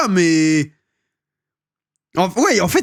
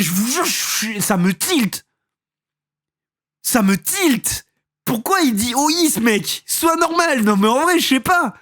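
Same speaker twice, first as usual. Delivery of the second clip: exasperated; 6 semitones higher than usual. Real, not acted. Exasperated reaction to video voice.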